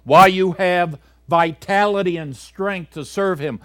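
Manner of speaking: loud, high, urgent pulpit exhortation; preaching at full force. Acted. Preacher urging young people to trust God, loud and fervent. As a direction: thunder it out to the young congregation.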